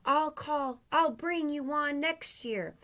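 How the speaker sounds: high, light promise; sustained high call in parting; Santa calling back. Acted. Santa calls from his sleigh.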